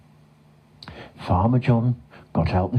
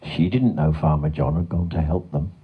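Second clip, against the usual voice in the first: usual speaking voice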